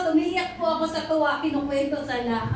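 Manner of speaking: ordinary delivery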